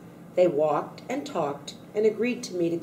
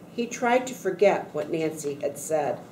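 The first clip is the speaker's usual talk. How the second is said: ordinary delivery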